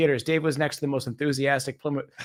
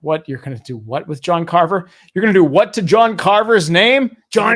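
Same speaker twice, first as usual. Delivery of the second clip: outraged locals; 4 semitones higher, 10 dB louder than usual. Retold or imitated. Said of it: "they would have been like".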